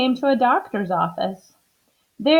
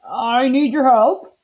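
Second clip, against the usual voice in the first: slow, louder plea for help; doctor asking; 4 semitones higher, 6 dB louder than usual. Acted. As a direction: press the helper to assist. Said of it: "said the doctor".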